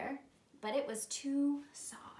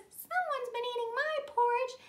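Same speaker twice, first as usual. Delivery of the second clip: very high, louder little bear voice; tiny bear; 13 semitones higher, 6 dB louder than usual. Acted. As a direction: pipe it up high like a wee bear. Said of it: "And the tiny bear says".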